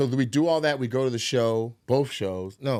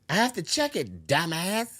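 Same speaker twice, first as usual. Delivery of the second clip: mock-rude retort, pitched up; hammed-up insult from the parking guy; mock rude yell; 4 semitones higher than usual. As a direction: sneer it back like the parking guy. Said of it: "he's yelling that shit back".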